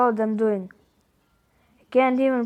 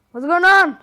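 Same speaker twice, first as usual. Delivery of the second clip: alarmed; 7 semitones higher, 9 dB louder than usual. Acted. Roxy alarmed by friend's text.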